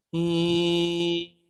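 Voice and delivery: him cut off tight on the mim; locked mim. Shown as a demonstration. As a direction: clip him shut. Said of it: "jangan ada lebih".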